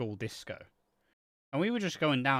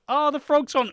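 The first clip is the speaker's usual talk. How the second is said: high, loud dismayed gasp of words; horrified at the squished frog; shocked; 11 semitones higher, 9 dB louder than usual. Retold or imitated. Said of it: "it's just like".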